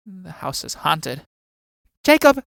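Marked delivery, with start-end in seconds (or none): 2.0-2.4 calling out. Acted. Mummy calls out to old man.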